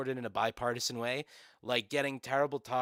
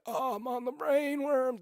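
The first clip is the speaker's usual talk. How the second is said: high, put-on mock voice, dopey and dazed; hammed-up mockery of a dazed politician; mocking RFK voice; 14 semitones higher, 3 dB louder than usual. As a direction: parody him rambling about the brainworm. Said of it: "he's funny when he's like".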